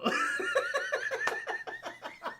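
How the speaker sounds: high, wheezy giggling laugh; amused laughter. Real, not acted. Teller amused by the villain's understatement, helpless laughter. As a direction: crack up at your own joke.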